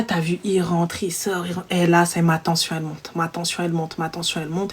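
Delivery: very low, hushed repetition; barely voiced, simmering to oneself; tense undertone. Agent feels tension rising during the call, hushed and low. Tension building as she retells.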